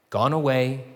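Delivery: ordinary talk, nothing marked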